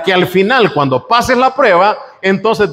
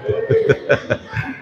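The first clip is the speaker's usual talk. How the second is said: a quiet breathy laugh under the breath; soft laugh; 5 semitones lower, 7 dB quieter than usual. Real, not acted. Preacher laughing softly at his own fridge joke. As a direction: let a small laugh slip out.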